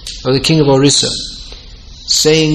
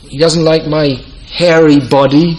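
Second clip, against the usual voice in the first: ordinary talk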